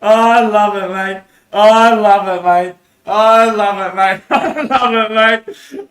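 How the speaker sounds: loud, slow, laughing I love it; excited exclaiming. Real, not acted. Delighted speaker cheering at his own idea, loud and laughing.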